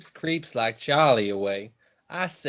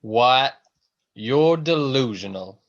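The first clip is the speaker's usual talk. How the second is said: loud scoff; 5 dB louder than usual. Acted. Son scoffs at his mother.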